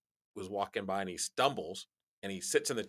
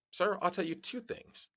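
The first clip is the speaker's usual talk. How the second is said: confident; 10 semitones higher than usual. Retold or imitated. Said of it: "I said".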